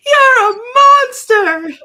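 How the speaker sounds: high, drawn-out wailing shout; mock-tearful cartoon cry; hammed-up accusation. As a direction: squeal it loudly like a tiny outraged cartoon captive. Acting out captive's defiance.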